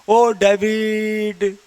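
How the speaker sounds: "ओ डेविड" with the वी stretched long, slow and loud; long stretched vowel in a call; drawn-out call to David. Shown as a demonstration. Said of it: "डेविड में वी को बड़ी करके बुला रहे हैं".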